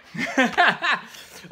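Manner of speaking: high burst of laughter; cracking up out of the bit; loud, real burst of laughter. Real, not acted.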